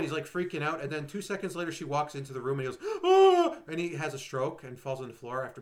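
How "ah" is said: high, loud hammed-up scream; mock scream. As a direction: shriek as he keels over. He has a stroke.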